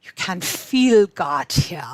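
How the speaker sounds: put-on slow and loud with a light African accent. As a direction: lean into each word, African accent, full of conviction. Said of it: "she spoke with this beautiful African accent".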